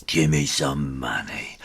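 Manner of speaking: lightly voiced bully; bully threat. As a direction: lean in and demand it. Bully demands money.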